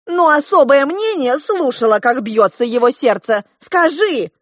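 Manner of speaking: high, loud, urgent protest; insistent appeal. A narrator's reading. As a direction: appeal loudly to a witness. Diana desperately appeals for confirmation. (громко, настойчиво взывая)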